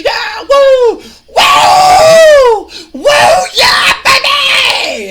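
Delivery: very high, loud whooping; wild celebration. Retold or imitated. Wrestler celebrates winning the match, ecstatic whooping. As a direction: shriek it like a gold medal win. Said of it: "He's celebrating like he just won".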